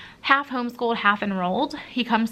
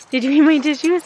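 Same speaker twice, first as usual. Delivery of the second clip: louder, higher mock scolding of the dog; talking to a dog; 6 semitones higher, 7 dB louder than usual. Real, not acted. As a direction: playfully scold the pup. Scolding dog about tissues.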